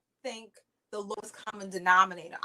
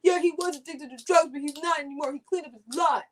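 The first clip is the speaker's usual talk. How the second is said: a hint of feeble Biden; lightly voiced weak defence; mock Biden; 4 semitones higher, 3 dB louder than usual. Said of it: "Biden would be like".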